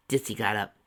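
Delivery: ordinary delivery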